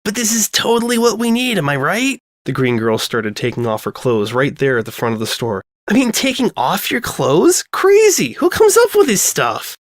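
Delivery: bubbly airhead getting carried away; giddy girl voice. Acted. Lyra gushing about the laundromat, high and giddy.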